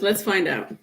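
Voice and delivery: usual speaking voice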